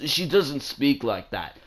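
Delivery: ordinary talk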